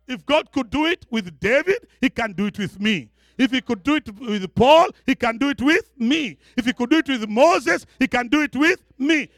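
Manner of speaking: high, rhythmic sermon build; rousing preaching. Real, not acted. Preacher claiming what God did for Moses, rousing preaching. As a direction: raise each condition, then land the answer.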